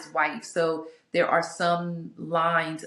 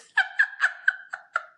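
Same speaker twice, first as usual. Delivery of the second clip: high, wheezy burst of laughter; real breathless laugh, high and wordless. Real, not acted. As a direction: crack up helplessly in a high giggle. Laughs at the question read out.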